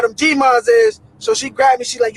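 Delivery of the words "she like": ordinary delivery, nothing marked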